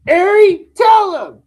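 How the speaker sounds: loud call across the room. Real, not acted. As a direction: shout his name to get his attention. Calling over to Ari.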